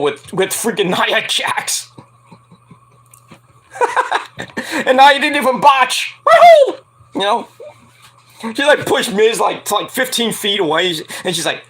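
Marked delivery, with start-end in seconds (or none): 4.9-6.8 laughing, then a high, loud woohoo; mock cheer. Real, not acted. Podcaster mock-cheers Nia not botching, laughing and whooping.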